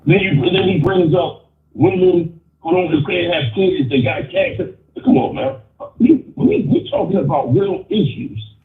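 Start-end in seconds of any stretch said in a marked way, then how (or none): none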